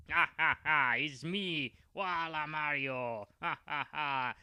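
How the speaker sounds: villain cackle. Acted. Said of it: "none other than Wala Mario".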